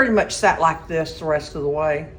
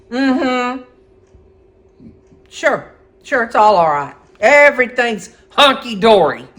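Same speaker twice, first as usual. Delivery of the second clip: tight, high, louder fake-cheerful sarcasm; sing-song it's all fine but seething; sarcastic tone; 4 semitones higher, 6 dB louder than usual. To her husband, insisting nothing is wrong, sarcastic. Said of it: "I think it was the tone in my voice".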